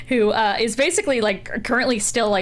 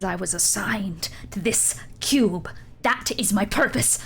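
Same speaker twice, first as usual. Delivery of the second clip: solemn dragon; 3 semitones lower, 4 dB quieter than usual. Acted. Dragon solemnly states its purpose.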